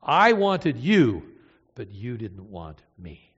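"I wanted you" slow, loud, stressed; aching contrast of wanted and want. Shown as a demonstration. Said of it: "It's the same word".

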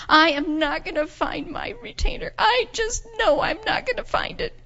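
put-on high, insistent child's wail; worked-up despair. Girl refusing to believe prayer works, high and worked up. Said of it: "just calm down".

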